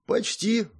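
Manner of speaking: high-pitched, noncommittal reply; evasive. A narrator's reading. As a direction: dodge the question lightly. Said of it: "уклончиво ответил Рольд".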